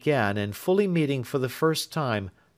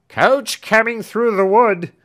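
loud slow call, higher, from a distance; 11 semitones higher, 10 dB louder than usual. A narrator's reading. Servant calls out to the family that the coach comes. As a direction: shout the coach's arrival to everyone. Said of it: "cried Britain".

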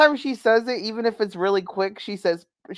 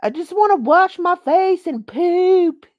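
much higher, louder, slow wailing imitation; mock tantrum scream; 10 semitones higher, 8 dB louder, slower than usual. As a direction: ham up her screaming fit.

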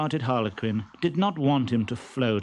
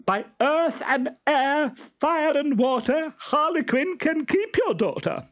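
shrieking. Acted. Stentorello fleeing Pantalone, shrieking high. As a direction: swear off her in terror. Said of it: "shrieked Stentorello".

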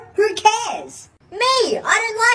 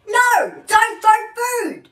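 stern scolding; 4 semitones higher, slower than usual. Acted. Mom scolding child.

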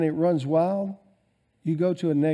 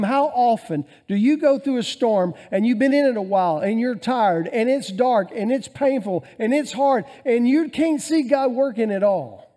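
louder, higher preaching build; 4 semitones higher, 4 dB louder than usual. Acted. Preacher pressing the church about storms, rising fervour. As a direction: drive it home to the congregation.

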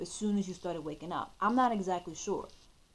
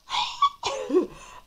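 squealing disbelieving laugh; stunned laughter; shocked high laugh; 6 dB louder than usual. Real, not acted. Reacting to coincidence.

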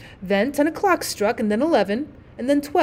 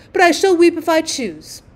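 defiant weeping; 6 semitones higher, 6 dB louder than usual. A narrator's reading. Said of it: "burst into real tears".

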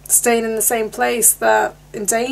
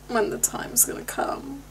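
whisper; 5 dB quieter than usual. Real, not acted. Whispering while watching.

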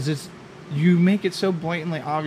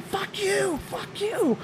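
imitating a furious driver, much higher; high, softer mock road rage yell; 7 dB quieter, much slower than usual. Mimicking the lady doing it again, a much higher mock angry yell. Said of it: "she's doing it again".

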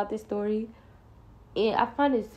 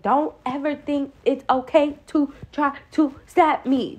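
slow, higher, word-by-word mama scolding; a mild imitation of a mother scolding; scolding mama, sounding it out; 5 semitones higher, 3 dB louder than usual. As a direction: spell it out like a mom disciplining her kid. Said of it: "starts sounding stuff out".

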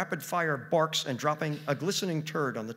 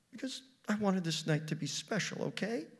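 higher, wavering toward tears; tearing up; 7 semitones higher than usual. A narrator's reading. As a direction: plead it, close to crying. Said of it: "tearing up".

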